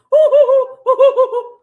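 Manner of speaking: shrill, loud mock cackle; mocking high cackle. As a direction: ham up her laugh, high and loud. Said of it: "she goes".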